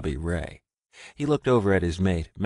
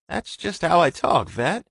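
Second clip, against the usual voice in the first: embarrassed defence; 10 semitones higher, 5 dB louder than usual. Acted.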